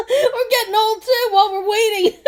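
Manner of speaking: loud, high, laughing impatient man; laughing mock yell. Man yelling while laughing.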